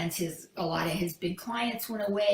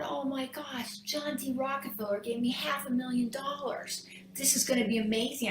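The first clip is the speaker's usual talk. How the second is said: hushed excitement; 5 semitones higher than usual. Retold or imitated. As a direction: marvel under your breath at sudden luck. Businessman thrilled at famous signature.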